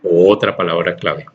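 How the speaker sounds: ordinary delivery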